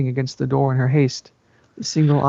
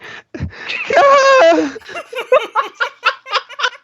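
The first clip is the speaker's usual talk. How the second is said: sobbing laugh. Acted. Breakdown after Elsa's refusal.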